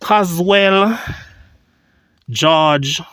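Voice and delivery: ordinary talk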